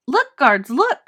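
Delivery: loud shouted alarm, pitch leaping up; boy shouting a trick to the guards. Acted. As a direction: yell it out to fool the guards. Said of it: "shouted Eric".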